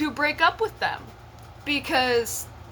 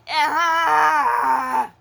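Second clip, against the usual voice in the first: loud exasperated groan-shout; frustrated yell; 4 semitones higher, 7 dB louder than usual. Real, not acted. Cringing at her teenage choices, loud frustrated yell. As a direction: yell at your own past foolishness. Mocking her younger self.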